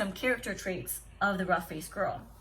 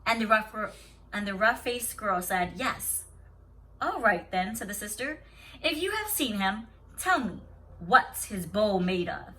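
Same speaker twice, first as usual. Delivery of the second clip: steady storybook reading to a class; story read aloud; 4 dB louder than usual. Acted. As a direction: read the tale calmly to the children. Teacher reads story aloud.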